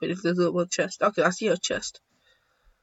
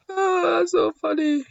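amused wail; 10 semitones higher than usual. Real, not acted. Amused by a lucky kill.

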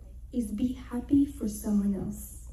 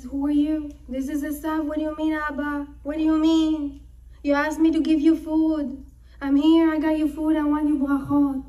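insistent retelling, building louder; agitated protest; 3 semitones higher, 5 dB louder than usual. Retold or imitated. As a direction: protest with growing urgency and volume. Acted confused quarrel with father.

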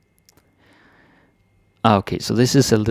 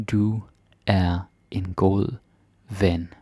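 slowed down; slower than usual. Shown as a demonstration. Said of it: "slow broken-up demo".

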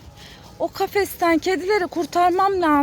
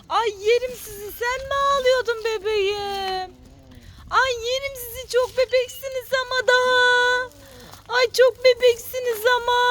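gushing over kittens; 6 semitones higher than usual. Real, not acted. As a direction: gush as if you could eat them up.

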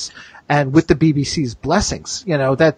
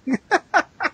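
high laugh; 12 semitones higher than usual. Real, not acted. Laughs after reading comment.

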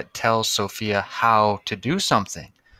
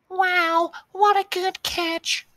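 high, bright cheering mom voice; excited mom; slower than usual. Acted. Mom praising her son at his game, high excited voice. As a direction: cheer him on like a proud mom. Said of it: "said his mom".

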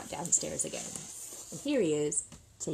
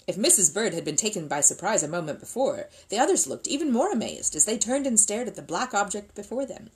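ordinary delivery, nothing marked

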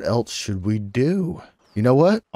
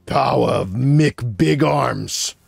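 slow, drawn-out hype announcer voice; mock hype announcer; 3 semitones higher, much slower than usual. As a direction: stretch it like a wrestling intro. Announcing pal's power move.

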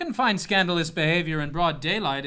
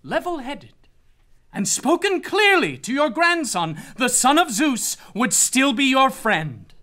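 higher, louder condemnation; stern judgment; 6 semitones higher, 5 dB louder than usual. Acted. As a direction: pass sentence on the grandfather. God condemns the family.

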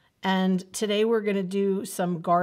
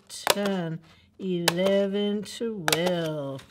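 put-on slow counting for little kids; teaching a child to count; much slower than usual. Shown as a demonstration. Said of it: "Can you count with me?".

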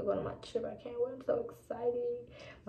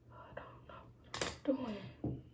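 slow, soft whisper of doubt; hushed, unsure whisper; 10 dB quieter, slower than usual. Real, not acted. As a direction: whisper it like a confession. Whispered confession about makeup.

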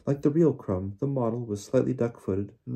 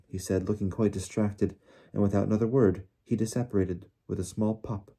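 ordinary delivery, nothing marked

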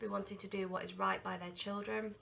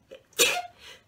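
cough; 10 dB louder than usual. Real, not acted. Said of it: "I'm gonna sneeze".

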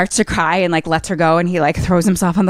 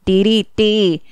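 higher, drawn-out singsong narration; cheery storybook singsong; playful scene-setting; 4 semitones higher, slower than usual. Pretend. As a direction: lilt through it like a silly nature tale.

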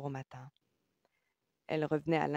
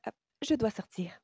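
abrupt, higher-pitched announcement; cutting tone; 6 semitones higher than usual. A narrator's reading. Woman announcing she must leave, oddly cutting. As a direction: state it sharply, brooking no questions. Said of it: "d'un ton étrangement coupant".

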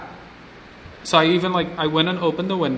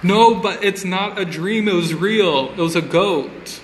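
insistent; 5 semitones higher, 3 dB louder than usual. Real, not acted. Insisting the story is true.

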